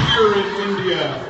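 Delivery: loud, slow, badly faked Indian; hammed-up mock-Indian accent, a little high; wife's awful Indian accent. Friend joins mocking the wife's Indian, terrible accent.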